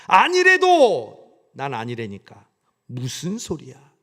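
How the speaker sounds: loud, slow, stamping-mad protest; indignant denial. Acted. Peter denying he knows Jesus, indignant and loud. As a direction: insist hotly, drawing it out. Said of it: "베드로가 펄펄 뛰면서".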